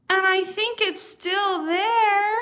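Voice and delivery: high, loud frightened cry; scared little bear. Acted. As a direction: cry it out like a terrified cub. Little Wee Bear fearful discovery.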